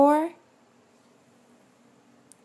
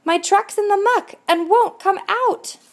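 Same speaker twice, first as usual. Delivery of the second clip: high, raised shout for help; shouting duck; 7 semitones higher than usual. A narrator's reading. Stuck duck shouts across the water for help, high and raised. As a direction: call out for help from the muck. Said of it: "hears the shout".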